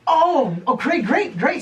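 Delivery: high, loud mock delight; acted startled enthusiasm. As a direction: act thrown, then gush too eagerly. Retells reacting to a surprise assignment.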